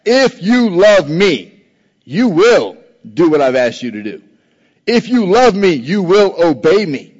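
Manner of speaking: If punched, said slow and firm as fact; emphatic, slow statement. Shown as a demonstration. Pastor to congregation, slow emphatic declaration. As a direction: drive it home as a certainty. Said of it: "It's a reality. It's a fact".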